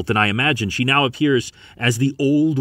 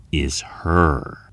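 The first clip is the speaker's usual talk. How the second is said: usual speaking voice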